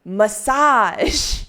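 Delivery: loud, high, drawn-out MASSAGE; grandly proclaimed. Pretend. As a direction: announce MASSAGE like a brand-new name, stretching it out. Proclaims it as a good name.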